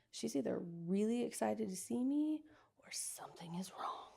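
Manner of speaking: hushed, fading whisper; whispered worry. Retold or imitated. Seeing her mother cry at customs, anxious whisper. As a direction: whisper it as a dawning fear. Retold thought at airport.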